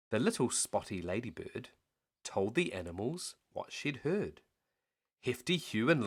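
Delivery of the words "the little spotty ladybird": plain reading voice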